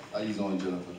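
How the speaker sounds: low, very soft come-on; deep sexy voice. Pretend. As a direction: murmur it like a slick pickup. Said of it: "in your sexy voice".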